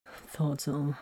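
soft, low-key mumble; quiet, resigned aside; mumbled. A narrator's reading. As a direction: mumble it under your breath. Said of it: "he mumbled".

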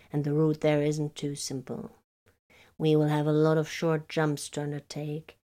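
lightly voiced stiff navigator; a hint of a formal old navigator. A narrator's reading. Navigator character voice.

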